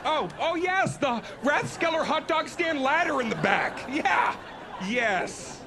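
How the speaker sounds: high, hammed-up eager agreement; mock enthusiasm. Comic faking eager delight at the bar owner's plan, high and hammy. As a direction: play along with false delight.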